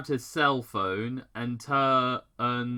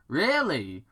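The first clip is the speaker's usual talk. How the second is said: sarcastic; 6 semitones higher, 5 dB louder than usual. A narrator's reading. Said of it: "Paige asked sarcastically".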